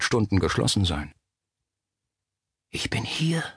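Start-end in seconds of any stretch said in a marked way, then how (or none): 2.7-3.4 faint, voiceless whisper from afar; barely audible ghostly whisper. A narrator's reading. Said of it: "Ein Flüstern, im stetigen Prasseln des Regens kaum zu hören".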